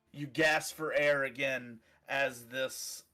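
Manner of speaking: ordinary talk, nothing marked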